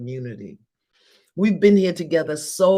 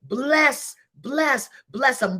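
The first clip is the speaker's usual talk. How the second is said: excited urging; 8 semitones higher, slower than usual. Real, not acted. Urging blessing, pitch climbing.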